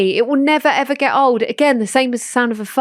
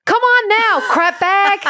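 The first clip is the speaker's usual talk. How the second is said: mock kid gamer; 9 semitones higher, slower than usual. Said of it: "he's like".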